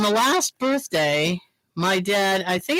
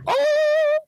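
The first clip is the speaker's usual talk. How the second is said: ordinary talk, nothing marked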